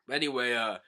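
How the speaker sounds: ordinary delivery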